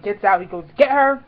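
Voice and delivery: ordinary delivery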